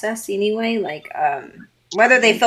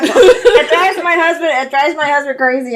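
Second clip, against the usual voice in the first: laughing; 6 semitones higher, 3 dB louder than usual. Real, not acted. Laughs through the line.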